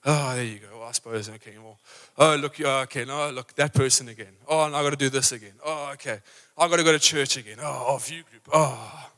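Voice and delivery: low, soft, sighing grumbles. Acted. Preacher acting a joyless churchgoer, low and sighing. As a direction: drag each word like life is a burden.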